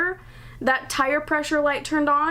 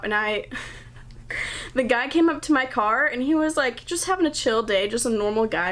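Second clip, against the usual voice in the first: ordinary talk, nothing marked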